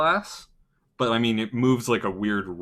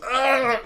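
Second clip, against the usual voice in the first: sudden yell; 6 dB louder than usual. Acted. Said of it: "he just kinda goes".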